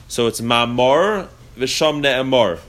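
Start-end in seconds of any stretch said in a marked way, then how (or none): 0.4-1.3 slow ma'amor ending in a rounded "-or"; ma'amor said slowly as "ma-MOR"; kamatz vowel. Shown as a demonstration. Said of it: "both with the word memor". 1.5-2.6 slow v'sham ne'emar, ending "-mor"; ne'emar with a rounded "aw" at the end; kamatz vowel. Shown as a demonstration. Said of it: "with the kamatz".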